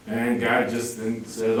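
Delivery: normal talking voice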